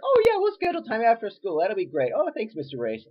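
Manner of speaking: high, bright mock politeness from the wife; a hint of an overly polite wife; super polite wife. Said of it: "his wife is, like, super polite".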